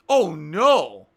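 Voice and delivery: loud alarmed oh no; alarmed cry. Real, not acted. Streamer sees disaster in game, loud alarmed cry. Something goes wrong in game.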